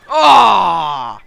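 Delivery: long, falling "aah" at full volume; loud yell. Real, not acted. Player mid-battle, long loud yell. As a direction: yell it out, open and held. Yelling during play.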